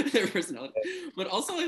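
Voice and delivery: bursting into a high laugh. Real, not acted. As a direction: laugh through the words. Laughing about outfits causing scenes.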